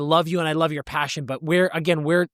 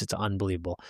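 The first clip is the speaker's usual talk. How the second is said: low catchphrase; 8 semitones lower, 9 dB quieter, faster than usual. Acted. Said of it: "podcast sign-off catchphrase".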